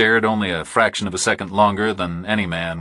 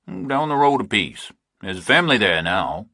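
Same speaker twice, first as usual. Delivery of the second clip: lightly voiced farmer; 3 semitones higher than usual. Narrator voices a rural farmer.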